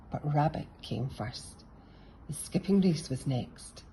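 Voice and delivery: ordinary delivery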